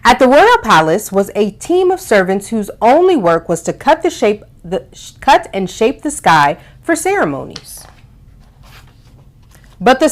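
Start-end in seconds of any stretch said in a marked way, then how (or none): none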